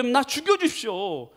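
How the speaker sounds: high, drawn-out angry plea; angry Jonah. Acted. Jonah asks God to let him die, angrily. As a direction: act out a sulking demand to God. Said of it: "화가 막 나는 거예요".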